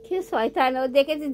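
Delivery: ordinary talk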